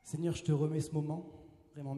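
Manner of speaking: usual speaking voice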